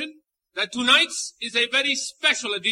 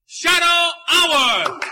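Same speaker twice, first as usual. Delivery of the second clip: big slow shout, higher; shouted announcement; 5 semitones higher, 5 dB louder, much slower than usual. Acted. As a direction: call it out to the whole crowd. Host's climactic announcement.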